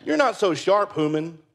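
very high, cheeky dog voice; mocking dog voice. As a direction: tease the human as the smug big dog. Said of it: "The big dog was looking at me going".

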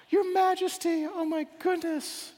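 very high, sing-song startled greeting; startled delight. Acted. Custodian turning round to find the king, startled.

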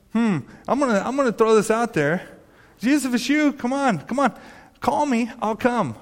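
high, eager dare; Peter's bold challenge. Retold or imitated. Peter calling out to Jesus on the water, high eager dare. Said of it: "you're probably thinking".

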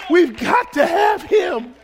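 shouted, high and loud; preacher's shout. Acted. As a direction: cry it out. Repeated line, loud then soft.

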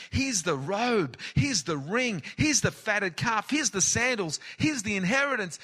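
high, loud, exultant giving; joyful father. Acted. Father welcoming lost son home, loud and joyful. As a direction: hand over each gift with delight.

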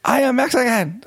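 very high-pitched, badly faked Mexican accent; mom's bad Mexican accent. Son imitating his mom's attempt, high fake Mexican accent. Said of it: "Mom, doing a Mexican accent".

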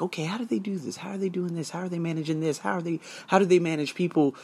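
quiet wondering inner voice; thinking aloud. Retold or imitated. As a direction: mutter the questions to yourself. Inner questions, hushed.

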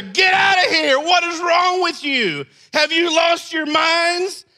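Jesus shouting at the vendors, furious. Shown as a demonstration. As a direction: drive them out in a rage. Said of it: "what I read is not this".

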